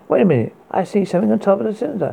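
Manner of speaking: fast, rising alarmed report; something terrible appears, react fast; sudden alarm. Acted.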